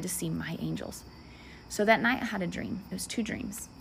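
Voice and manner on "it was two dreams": usual speaking voice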